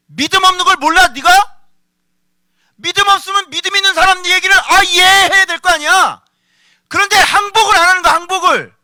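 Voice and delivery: 높고 크게 몰아치는 꾸짖음; 믿음 없음을 호되게 질책하기; 격렬한 설교. Acted. 격하게 꾸짖는 설교. (불같이 꾸짖는 설교조)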